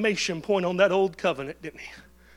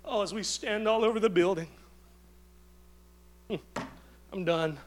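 ordinary delivery

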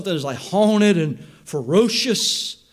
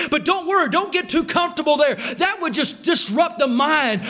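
preaching at full force; 6 semitones higher than usual. Acted.

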